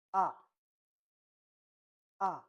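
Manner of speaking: AH short and sharply falling; fourth tone. Shown as a demonstration. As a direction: drop AH fast from the top. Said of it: "the last tone".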